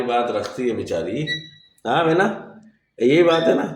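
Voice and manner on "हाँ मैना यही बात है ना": coaxing the bird. Real, not acted. Coaxing pet bird to talk.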